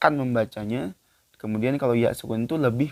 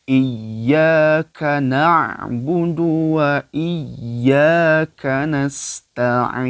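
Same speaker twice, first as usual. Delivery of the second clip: slow, louder melodic Quran recitation; 3 semitones higher, 6 dB louder, much slower than usual. Acted. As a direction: chant the verse slowly like tilawah. Recites verse. (bacaan tilawah pelan dan lantang)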